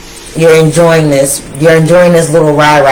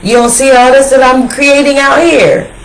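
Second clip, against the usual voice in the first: much higher, incredulous, half laughing; high showing-off quote; 7 semitones higher than usual. Pretend. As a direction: brag like you can't believe they don't see it. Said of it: "you're like".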